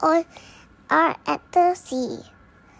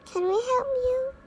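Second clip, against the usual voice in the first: higher, fast eager ask; high quick question; 8 semitones higher, 4 dB quieter, faster than usual. Acted. Peter asks the man.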